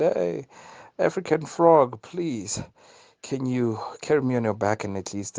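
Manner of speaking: high, urgent plea for a ride; high pleading scorpion. Acted. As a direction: call out and beg the frog to carry you. Scorpion begging frog for help.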